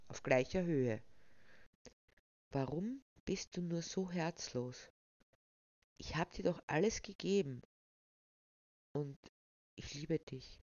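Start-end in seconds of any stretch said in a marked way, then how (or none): 2.5-10.6 leise, verzweifelt flehend; leises Flehen. A narrator's reading. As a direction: bitte sie kaum hörbar, gekränkt. Er fleht seine kalte Frau an. (leise und verletzt flehen)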